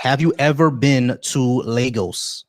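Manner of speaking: measured, carefully separated words for someone who didn't catch it; slow, deliberate repeat. Real, not acted. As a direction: spell out "Have you ever been to Lagos" slowly, word by word. Repeats question after listener says "What?.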